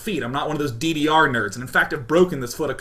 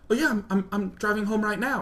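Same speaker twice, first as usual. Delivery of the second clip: nervous meekness; 5 semitones higher, 3 dB quieter than usual. Retold or imitated. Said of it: "I become a gigantic quivering loser".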